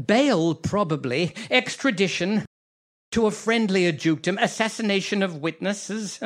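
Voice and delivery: high, quick, giddy scheming; gleeful gloating. Acted. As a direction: crow with delight over getting away. Villain gloats over escape plans.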